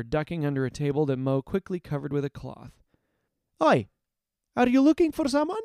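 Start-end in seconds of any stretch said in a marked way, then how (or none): 3.6-5.6 high, loud, fast call-out; shouting. Acted. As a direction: yell at the thugs to draw them. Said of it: "Zeri shouted at the lackeys".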